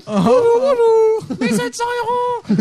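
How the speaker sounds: put-on loud high falsetto old woman; shrill old lady impression, crying out; imitating his mother. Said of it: "il imitait la voix de sa mère". (voix de vieille mère imitée, aiguë et forte)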